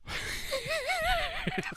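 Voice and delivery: a high, breathy burst of laughter. Real, not acted. Laughing at names.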